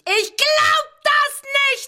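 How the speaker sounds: sehr hoch, laut, gedehnt; hysterischer Aufschrei. Acted. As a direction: das kreischend wiederholen. Hysterischer Ausbruch. (hoch und laut gekreischt)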